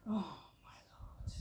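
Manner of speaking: slow, hushed, whispered exclamation; exasperated whisper. Acted. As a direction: whisper it in weary disbelief. Acted exasperation at husband's habit.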